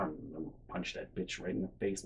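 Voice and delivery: low, soft seething growl; muttered threat. Retold or imitated. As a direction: imitate a friend muttering violent threats. Acted friend muttering threat.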